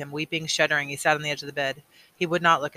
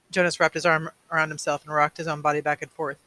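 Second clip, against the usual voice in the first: plain reading voice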